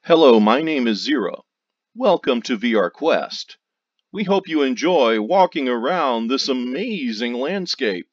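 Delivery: loud, projected welcome with swinging pitch; game character greeting. Pretend. Recording a virtual guide's welcome for players, projected presenter voice. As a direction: perform a big lilting welcome for the recording.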